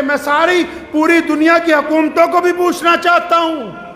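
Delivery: high, sustained sermon delivery; impassioned preaching. Real, not acted. Preacher challenging governments over delayed marriage, impassioned preaching. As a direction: press the charge from the pulpit.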